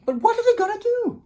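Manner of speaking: high anxious tiny voices; worried little wolves. Acted. Wolves worrying.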